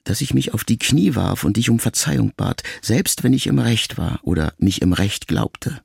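usual speaking voice

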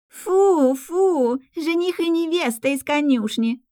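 high, sing-out playground taunt; teasing shout. A narrator's reading. Red-haired girl taunts the pair, shouting high. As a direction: jeer it high, stretching the words. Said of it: "закричала рыжая Герика".

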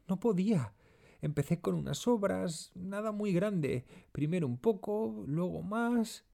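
high, quick, distraught wail; moaning, high-pitched servant pleading to be understood; whimpering. A narrator's reading. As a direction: whimper out a desperate confession, words rushing. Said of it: "Cristina gime".

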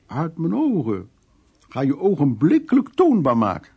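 pointing at the door, shouting him out of her sight; aunt shouting. Retold or imitated. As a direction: bark the order out like a furious aunt. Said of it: "tante Kokko roept".

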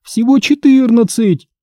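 high, slow desperate shouts; shouting back. Acted. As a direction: yell back at the ghost in protest. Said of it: "закричал я". (отчаянный крик)

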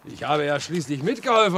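normal talking voice

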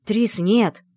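loud sharp shout. A narrator's reading. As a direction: shout to stop her. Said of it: "крикнул Талис".

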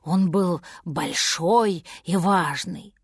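ordinary delivery

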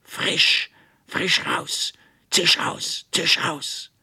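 loud high hiss, barely voiced; hissing witch. A narrator's reading. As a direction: spit the words out like a snake. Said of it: "zischte sie". (zischende Hexe, laut und hauchig)

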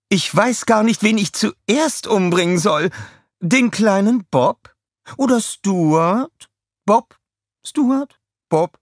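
high, slow teasing threat; gloating villainess. A narrator's reading. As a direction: toy with the prisoners before the threat. Scarlett threatens the captives. (hohe, langsame, genüsslich drohende Schurkin)

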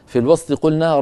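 ordinary talk

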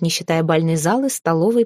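plain reading voice

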